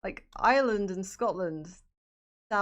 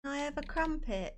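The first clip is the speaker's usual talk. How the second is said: higher, put-on British accent; 7 semitones higher than usual. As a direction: ask in an exaggerated British accent, pitched up. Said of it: "I'm putting on an accent".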